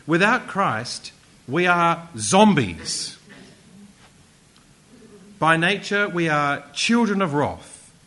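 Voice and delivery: loud, slow, punched-out declaration; slow, loud preacher's proclamation; preaching emphasis. Real, not acted. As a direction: hammer it home to the congregation. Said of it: "emphatic preaching".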